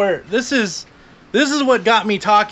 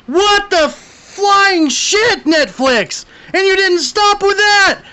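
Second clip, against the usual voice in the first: outraged rant; 10 semitones higher, much slower than usual. Real, not acted. Venting at Netflix over four-episode seasons, slow and outraged. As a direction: spit it out slowly at Netflix.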